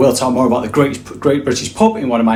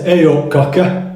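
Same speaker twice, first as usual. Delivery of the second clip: slow Lancashire greeting; Lancashire accent; slower than usual. Shown as a demonstration. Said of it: "Lancashire ... will say".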